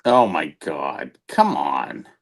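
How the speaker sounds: usual speaking voice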